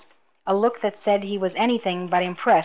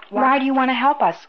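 firm, pointed question, pitched up; 5 semitones higher, 4 dB louder than usual. A narrator's reading. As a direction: ask it firmly, with a higher opening. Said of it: "Luke inquired firmly".

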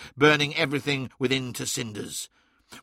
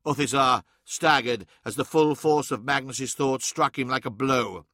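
plain reading voice

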